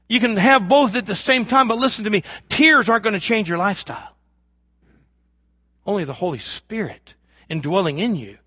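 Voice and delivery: usual speaking voice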